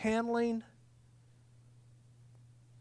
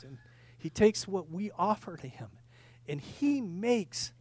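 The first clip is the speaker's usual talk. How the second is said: normal talking voice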